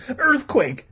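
high, loud warning cry; alarmed shout. A narrator's reading. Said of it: "Todd shouted".

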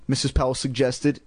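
plain reading voice

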